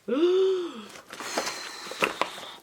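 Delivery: sharp high gasp of delight; surprised gasp. Real, not acted. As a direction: gasp as the box opens. Opening the box. (petit cri de surprise aigu)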